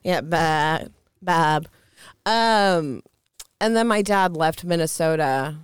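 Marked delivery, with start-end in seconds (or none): 0.2-1.7 "Bab" with a bleating short a; goat bleat. Pretend. Woman mocking goat-faced cheater, bleating "Bab". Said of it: "I gotta say it like that".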